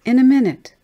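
put-on high, loud little-girl call; small child's shouted reply; child calling back. Child calls back to mother.

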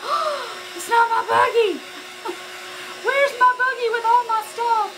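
high, loud, laughing alarm; retold panic. Woman at the checkout realising it's not her buggy, high laughing panic. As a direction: squeal it like you just lost your cart. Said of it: "I was like".

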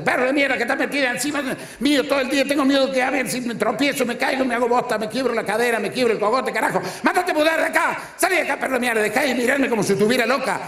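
high, fast, fed-up wife yelling at the dog; a high, fast wife chewing out the dog; ranting wife. Retold or imitated. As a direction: shoo the dog off as the frazzled wife.

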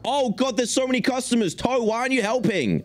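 high panicky yelps; mock panic. Overwhelmed by customers, a high mock-panic squeal.

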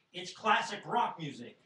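loud, high, drawn-out objection; indignant protest. Retold or imitated. Eight-year-old to his father defending Kiss, indignant. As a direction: protest it like an offended kid.

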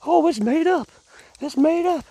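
high-pitched mocking whine; mock commenters. Vlogger mimicking commenters who doubted the story, high mockery. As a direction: imitate doubters in a silly high voice. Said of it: "swimming in all them comments".

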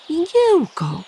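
high, ringing call of a name; mother calling out. A narrator's reading. Mother calling her son Igiełka, high call. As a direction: call the little hedgehog to come. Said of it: "zawołała Nastańka".

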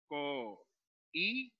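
"co-i-be" slowly, with long gaps between syllables; teacher splitting "co-i-be" at the hiatus; separated syllables. Shown as a demonstration. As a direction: sound out "co-i-be" one syllable at a time. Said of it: "por lo tanto hay que separar".

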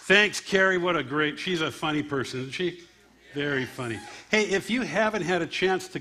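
loud crowd-pumping host; host cheering the comic offstage, loud announcer call; emcee hype. Real, not acted. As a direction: bring the room up after an act.

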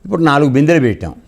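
ordinary talk

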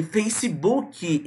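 "Facebook" slowly as "feici-bukee"; added vowels. Shown as a demonstration. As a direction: drag out "Facebook" with Brazilian ee sounds. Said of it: "você estende essa vogal". ("Facebook" devagar, como "feicibuqui")

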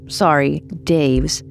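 usual speaking voice

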